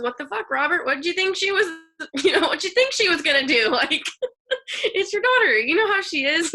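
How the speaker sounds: high, loud mock scolding broken by laughs; retold outrage. Sky telling off the dad for upsetting his daughter, high loud mock outrage. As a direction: play the cousin's indignant rant at Robert, laughing.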